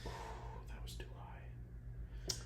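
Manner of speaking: slow, hushed whisper aside. Real, not acted. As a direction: whisper it like a secret. Whispered aside about score.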